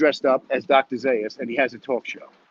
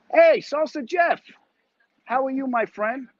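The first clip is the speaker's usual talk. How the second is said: high, slow, drawn-out hello; excited greeting; 12 semitones higher than usual. Real, not acted. Greeting Salsa Jeff in the chat, high and drawn out. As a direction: sing out a big hello to a friend.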